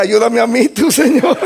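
high, laughing plea; real laughter through a mock plea; laughing prayer. Real, not acted. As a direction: laugh as you beg for help. Laughing at his own prayer.

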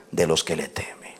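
muy bajo y grave, casi susurrado; susurro reverente. Real, not acted. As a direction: baja la voz con reverencia, alargando las palabras. Reverencia al cerrar el versículo. (susurro de reverencia)

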